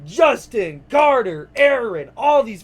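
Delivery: loud, high cheering shouts; cheering names. Real, not acted. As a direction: yell each name for your friends. Cheering for graduates.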